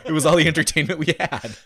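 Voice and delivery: hammed-up grandpa recalling hard times; host teasing himself as an old man; Depression-era old-timer. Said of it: "make me sound like".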